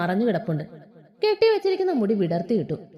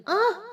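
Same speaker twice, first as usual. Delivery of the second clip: short pained yelp; 9 semitones higher than usual. Acted. She bites his hand.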